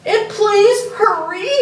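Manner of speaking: high, loud, slow plea; urgent yell. Acted. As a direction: shout it, urgent. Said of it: "I yelled".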